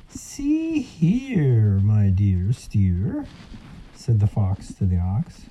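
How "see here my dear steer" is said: sly silky fox. Acted. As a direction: coax him like a smooth sly fox. Fox character speaks.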